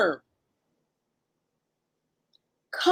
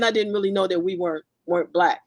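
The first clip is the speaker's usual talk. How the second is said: usual speaking voice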